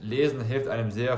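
usual speaking voice

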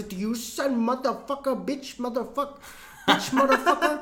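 high, rising mock rant from the caller, laughing through it; angry scammer impression. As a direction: play the furious caller cursing back, half laughing. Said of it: "he just immediately goes".

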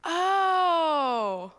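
long, loud, high amazed oh; a toddler's wonder, drawn out; awed oh. Retold or imitated. Niece realising Jesus is near, long amazed oh. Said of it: "And she goes".

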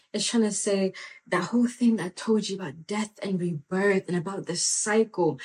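ordinary talk, nothing marked

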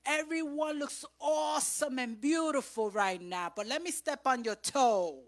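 loud, high shouted point; preaching shout. Acted. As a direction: call it out to the church.

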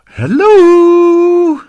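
very high, loud, over-happy singsong greeting; Lucky. Acted. Happy hippo greeting a stranger, squeaky over-cheerful voice. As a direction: squeal a long, gleeful hippo hello. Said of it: "You sound a little bit too happy".

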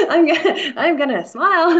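giggling while listing what she'll do; laughing. Real, not acted. Laughing at her own plan to mime.

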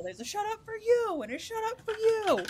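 high, playful handing-out of shut ups; mock shut ups. Joking about telling everyone to shut up, playfully high. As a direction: dole them out like a sassy talk-show host.

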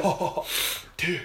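throaty laugh landing on a surfer-like "dude"; Keanu Reeves. Acted. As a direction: imitate a dazed movie dude. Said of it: "You gotta get the dude in there".

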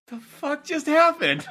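high, drawn-out disbelief; bewildered. Retold or imitated. Storyteller reliving a kick from a stranger, bewildered. Acting out his baffled reaction.

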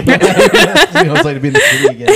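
high, fast and loud through laughter; laughing. Real, not acted. Host teasing about city life, laughing hard. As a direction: crack up while talking.

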